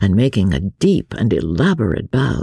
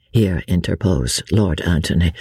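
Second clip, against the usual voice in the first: plain reading voice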